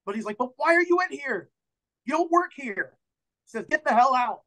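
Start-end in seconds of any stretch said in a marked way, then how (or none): 0.4-2.9 high, exasperated challenge; indignant bar owner. Acted. Bar owner questioning strangers in his bar, indignant high voice. 3.7-4.4 raised, high-pitched order to leave; angry bar owner. Acted. Bar owner ordering intruders out, angry raised voice.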